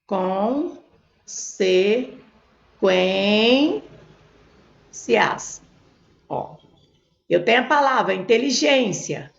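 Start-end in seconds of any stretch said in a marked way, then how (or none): 0.1-5.6 "Consequências" very slowly, each syllable held apart. Shown as a demonstration. Teacher separating syllables on the board, "Consequências" very slowly split. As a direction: draw out each syllable of "Consequências" with long gaps. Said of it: "vamos separar a sílaba aqui".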